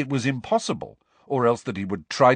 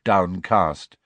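usual speaking voice

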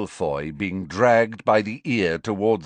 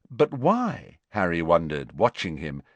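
usual speaking voice

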